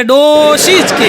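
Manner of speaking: scornful ડોશી; dismissive old-woman jibe. Retold or imitated. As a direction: sneer ડોશી at the mother-in-law. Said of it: "જેવી એના પિયરમાં જાય એટલે".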